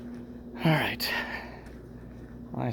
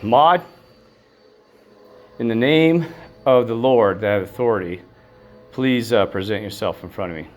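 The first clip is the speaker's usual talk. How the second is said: calling out; 3 semitones higher, 7 dB louder than usual. Real, not acted. Commanding spirit in Lord's name.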